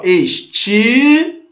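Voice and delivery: "estilo" drawn out in separate syllables. Shown as a demonstration. Teacher explaining which vowels join, "estilo" syllable by syllable. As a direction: stretch "estilo" slowly, holding the "ti". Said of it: "Separa ali".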